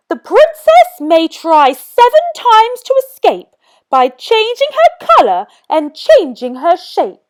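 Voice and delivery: fairy godmother. A narrator's reading. Fairy godmother casts her spell, high and grand.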